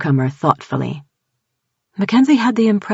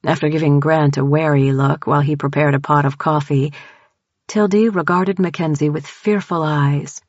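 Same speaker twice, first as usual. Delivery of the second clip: plain reading voice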